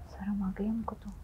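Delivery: very soft, near-whispered aside; embarrassed hush. Acted. Woman confiding what she saw, embarrassed hush. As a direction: drop to a shamefaced murmur.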